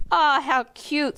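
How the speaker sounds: put-on girl squealing at the duck. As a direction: coo over something cute. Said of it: "squealed Bristol".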